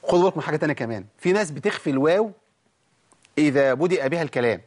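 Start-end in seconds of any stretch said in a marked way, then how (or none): none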